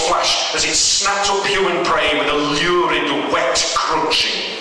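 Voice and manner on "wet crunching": usual speaking voice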